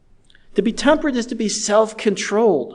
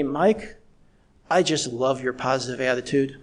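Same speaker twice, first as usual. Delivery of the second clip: deadpan sarcastic praise, lower than usual; retold sarcasm; 5 semitones lower, 4 dB quieter than usual. Someone in authority telling Mike about his attitude, understated sarcasm. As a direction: quote a dry, understated jab, pitched low. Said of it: "He was being sarcastic".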